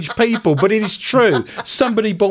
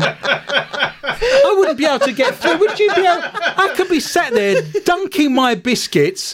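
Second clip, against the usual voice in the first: high, slow, laughing incredulity; laughing disbelief; 9 semitones higher than usual. Real, not acted. Host picturing himself at the cafe, laughing disbelief. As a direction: squeal it out through laughter.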